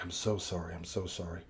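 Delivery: drugged patient apologizing to the orderly, softly; sleepy apology. Retold or imitated. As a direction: drift back down, woozy and meek. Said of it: "very apologetic".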